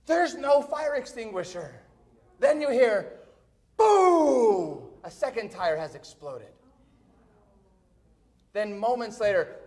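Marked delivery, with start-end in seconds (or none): 3.8-4.9 loud, high, long falling boom; booming explosion imitation. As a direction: explode on the word. Imitates second tyre exploding.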